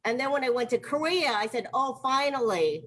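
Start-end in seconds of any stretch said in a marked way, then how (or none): none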